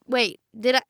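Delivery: ordinary delivery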